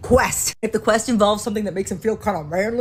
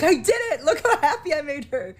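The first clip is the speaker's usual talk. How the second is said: proud husband; 8 semitones higher than usual. Retold or imitated. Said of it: "He's just, like, beaming".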